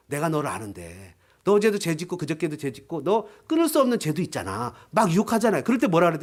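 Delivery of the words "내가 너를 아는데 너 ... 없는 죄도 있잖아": low, lightly acted tempter; devil's accusation. Devil reminding a believer of past sins, low knowing accusation.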